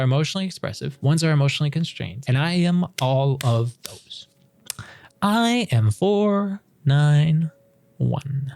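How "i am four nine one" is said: slow drawn out. Real, not acted. Announcing her own result.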